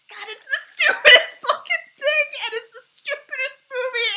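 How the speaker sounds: high, laugh-choked squeal; helpless laughter. Real, not acted. Friend cracked up by the in-joke, laughing helplessly. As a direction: squeeze the words out through laughter.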